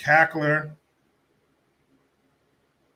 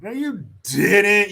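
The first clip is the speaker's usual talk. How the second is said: higher, louder, slow drawn-out no you didn't; loud disbelief; 8 semitones higher, 7 dB louder, slower than usual. Real, not acted. Calling out Kamala's story, loud drawn-out disbelief. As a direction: stretch it out, calling her bluff.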